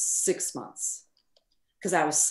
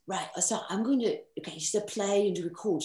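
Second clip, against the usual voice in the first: put-on British accent; 2 semitones higher, 4 dB quieter than usual. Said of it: "I'll try to do the accent".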